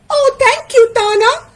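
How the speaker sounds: very high character voice, grateful; high-pitched thankful friend; Taín, grateful. Acted.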